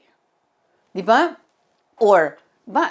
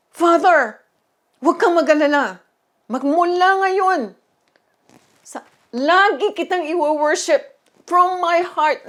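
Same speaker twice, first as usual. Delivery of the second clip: fervent promise to God; 3 semitones higher, 4 dB louder than usual. Retold or imitated. Believer vowing to comfort God, loud and earnest. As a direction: promise him with all your heart.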